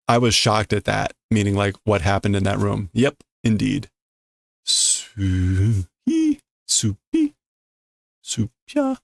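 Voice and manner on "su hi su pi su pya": whistling, rising mock snores; comic snoring from down the hall; snoring sounds. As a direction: wheeze out snore noises. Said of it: "More sounds still from down the hallway".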